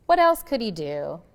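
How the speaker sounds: plain reading voice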